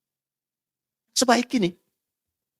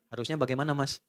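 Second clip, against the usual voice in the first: soft patient wife; 6 semitones lower, 9 dB quieter than usual. Retold or imitated. Wife answering calmly.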